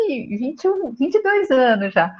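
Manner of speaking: ordinary talk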